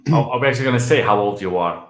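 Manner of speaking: ordinary talk, nothing marked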